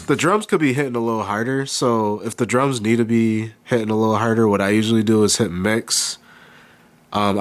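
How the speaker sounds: ordinary delivery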